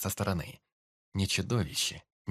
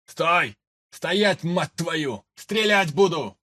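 angry shout; 8 semitones higher, 9 dB louder, much slower than usual. Acted. Soldiers chasing, threatening fire.

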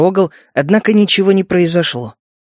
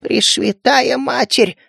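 high slow bleating cry; 6 semitones higher, slower than usual. Acted. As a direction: bleat it out like a goat. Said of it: "проблеял Жаба".

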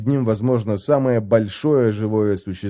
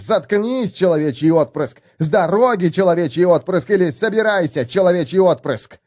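mimicking dwarf orders; 7 semitones higher than usual. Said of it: "только и услышишь".

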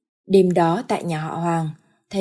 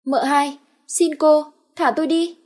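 pleading; 8 semitones higher, 4 dB louder than usual. Acted. Beaten maid begs mistress.

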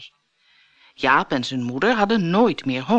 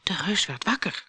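ordinary delivery, nothing marked

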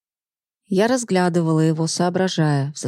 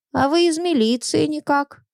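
perked up; 9 semitones higher than usual. Acted. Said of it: "Сразу оживилась Егоровна".

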